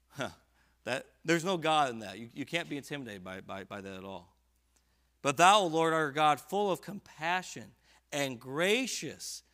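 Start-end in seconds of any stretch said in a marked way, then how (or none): 5.2-9.4 "But thou, O Lord" lifted high and loud; triumphant turn to God, higher and louder; soaring "But thou". Acted. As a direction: cry out "thou" with rising pitch. Repeats verse with fervor.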